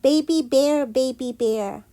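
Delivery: ordinary delivery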